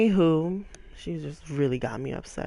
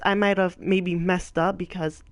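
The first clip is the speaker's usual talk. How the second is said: ordinary talk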